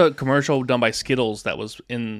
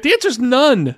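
gleeful; 11 semitones higher, 8 dB louder than usual. Real, not acted. Quiz host revealing answer.

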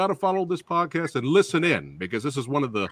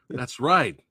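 ordinary delivery

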